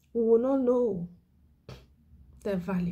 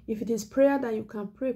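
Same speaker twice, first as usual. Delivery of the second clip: ordinary talk, nothing marked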